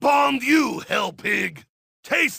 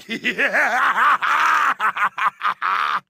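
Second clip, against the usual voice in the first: maniacal laugh. Acted. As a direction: cackle over the carnage. Gloating over killed zombies.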